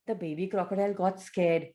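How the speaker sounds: ordinary delivery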